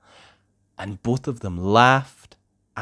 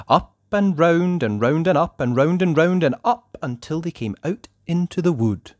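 ordinary delivery

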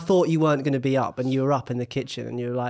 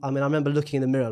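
usual speaking voice

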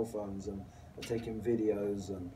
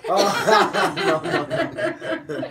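laughing denial; 9 semitones higher, 13 dB louder, much slower than usual. Real, not acted. Laughing off prison body joke.

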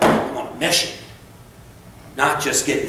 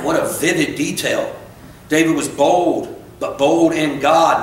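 normal talking voice

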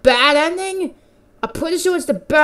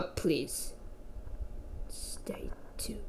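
whispering; 9 semitones lower, 22 dB quieter, much slower than usual. Real, not acted. Reading the game's closing message.

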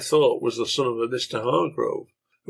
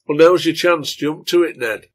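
louder, higher egging-on; urging; 5 semitones higher, 7 dB louder than usual. A narrator's reading. Fellow drinker urging Ned to defy father, goading. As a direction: goad him into acting now. Bar crony urges Ned on.

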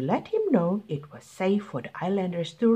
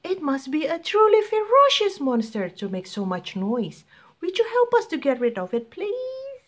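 high, pleading pilot voice, quick on the request. Acted. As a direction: beg the beast for help. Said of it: "said the pilot".

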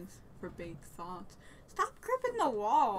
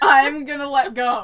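laughing; 10 dB louder than usual. Real, not acted. Laughing threat mid game.

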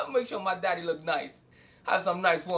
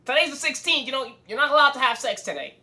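a louder, higher mock scolding mother; mock stern mom; 5 semitones higher, 5 dB louder than usual. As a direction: play the bossy mom laying rules.

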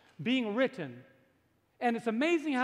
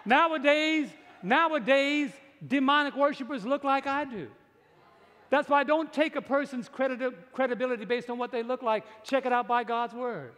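raised, high-pitched pulpit delivery; forceful preaching; 4 semitones higher, 4 dB louder than usual. Real, not acted. Preacher warns congregation about demonic worshipers, forceful preaching. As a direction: preach it louder and higher, insistently.

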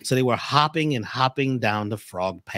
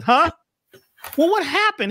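very high, loud frog voice, puzzled; a deaf frog who caught nothing; 6 dB louder than usual. Acted. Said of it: "And the frog said".

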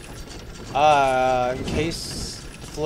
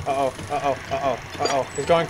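normal talking voice

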